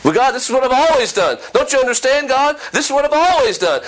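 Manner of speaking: high, rushed protest to God; pleading protest. Acted. A believer arguing with God over old habits, pleading protest. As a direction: object insistently, pitched well up and hurried.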